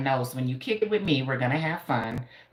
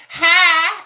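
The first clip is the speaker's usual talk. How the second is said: very high, loud Southern "Hi"; Georgia accent; 12 semitones higher, 10 dB louder than usual. Retold or imitated. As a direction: squeal a drawn-out Georgia greeting. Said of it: "especially when somebody says".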